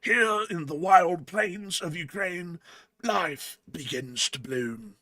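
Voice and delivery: slow, hushed nature documentary narrator; measured British naturalist voice; David Attenborough. Acted. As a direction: narrate it slowly like a wildlife film, with reverent pauses. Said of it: "my best David Attenborough voice".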